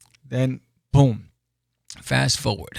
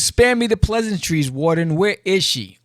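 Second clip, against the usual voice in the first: stern demand; 5 semitones higher, 4 dB louder than usual. Acted. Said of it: "So it says".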